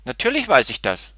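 put-on high, loud child's retort; indignant piglet. A narrator's reading. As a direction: insist like a little one who knows. Piglet answers goat indignantly.

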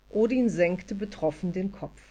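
ordinary delivery

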